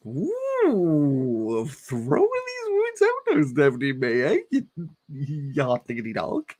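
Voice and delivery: high, sing-song teasing of the author; mock teasing voice. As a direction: tease the writer in a put-on voice.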